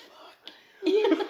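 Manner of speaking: high, laughing fuck you; laughing curse. Retold or imitated. Retelling flipping off a customer mistaken for sister, laughing high. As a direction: squeal it through laughter.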